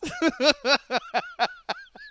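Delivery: high cackling laugh; burst of laughter. Real, not acted. Laughing about getting only thirty dollars, a high cackle. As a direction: crack up at the joke.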